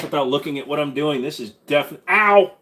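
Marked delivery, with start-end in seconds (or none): 2.1-2.5 sudden loud ow; real yelp after a knife slip; yelp of pain. Real, not acted. Streamer cuts himself with a knife and yelps. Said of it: "not smart".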